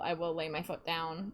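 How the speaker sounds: usual speaking voice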